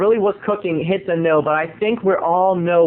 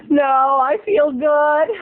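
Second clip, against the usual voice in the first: breathless Laura; 8 semitones higher, much slower than usual. Retold or imitated. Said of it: "Laura's reply".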